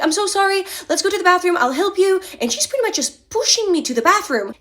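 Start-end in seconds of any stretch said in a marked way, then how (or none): 0.0-2.2 high, sweet, overly concerned friend; Monica's sympathy. Retold or imitated. Stranger fussing over her spilled coffee, high sweet concern. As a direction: coax her toward the bathroom. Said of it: "She's like".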